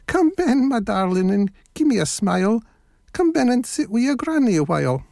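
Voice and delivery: high put-on granny voice; wolf posing as a granny; fake old lady. A narrator's reading. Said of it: "his best old lady's voice".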